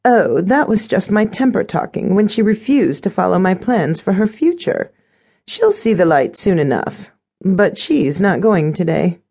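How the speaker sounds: high stepmother voice, slowing at the end; dismissive stepmother. A narrator's reading.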